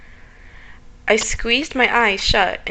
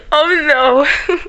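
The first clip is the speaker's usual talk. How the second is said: high, loud frightened cry; scared whimper; 5 semitones higher, 7 dB louder than usual. Real, not acted. Someone watching her.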